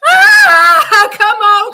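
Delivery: loud, high cry of hope; excited shout. Real, not acted. Fan pleading with the team on TV, loud high shout. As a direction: urge them on.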